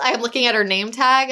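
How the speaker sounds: usual speaking voice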